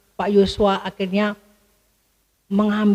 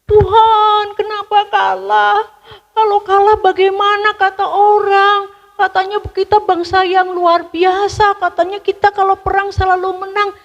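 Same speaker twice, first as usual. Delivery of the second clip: higher, louder lament to God; pleading prayer; 10 semitones higher, 4 dB louder than usual. A narrator's reading. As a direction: cry out to God in dismay. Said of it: "langsung sujud". (Yosua meratap kepada Tuhan, suara tinggi)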